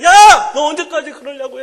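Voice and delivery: a loud mock yell at the door, then dropping; mother nagging her son in his room, mock yell; nagging mother's yell. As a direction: yell through the door like an exasperated mom. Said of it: "막 그러고".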